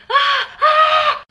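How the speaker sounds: screamed panic at the spider; freaking out. Retold or imitated. Panicked at the spider, a screaming shriek. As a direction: shriek high and loud in terror.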